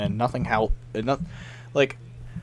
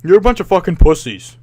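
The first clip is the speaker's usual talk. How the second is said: louder, higher mock taunting opponent; 3 semitones higher, 9 dB louder than usual. Imitating an opponent taunting his team. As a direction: taunt like a sore loser. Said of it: "the guy was like".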